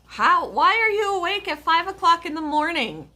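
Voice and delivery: amazed high question to a viewer; surprised. Real, not acted. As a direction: sound genuinely startled by the hour. Surprised at viewer awake 5am.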